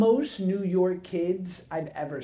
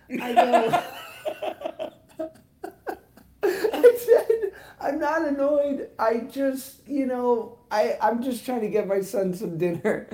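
laughing; 6 semitones higher, 5 dB louder, slower than usual. Real, not acted. Laughing while retelling embarrassment.

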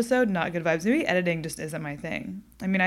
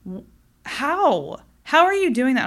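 baffled; 8 semitones higher, 8 dB louder than usual. Retold or imitated. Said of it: "I'm like".

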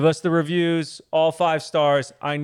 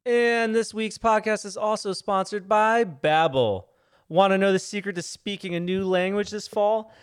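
bright, slower scripted ad read; 4 semitones higher, slower than usual. Acted. Podcast host reads a Babbel sponsor spot, ad read voice. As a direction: pitch the product like a radio spot.

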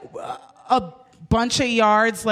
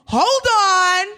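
sassy; 7 semitones higher than usual. Retold or imitated. Demanding to roll the cab window down, sassy indignation. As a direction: assert your rights with mock outrage. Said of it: "I was still a little sassy".

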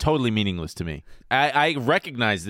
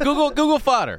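high excited; 12 semitones higher, 3 dB louder than usual. Real, not acted. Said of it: "spelling then googling".

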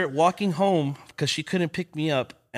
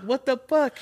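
much higher mock disbelief; shocked; 10 semitones higher than usual. Kid getting jumped by a gang, mock shock. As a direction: blurt it in shock.

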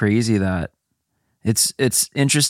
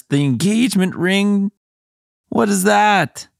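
mock incredulous; 11 semitones higher than usual. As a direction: scoff at it in a high voice. Mocking the ex's proposal.

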